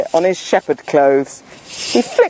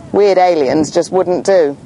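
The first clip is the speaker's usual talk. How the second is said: ordinary delivery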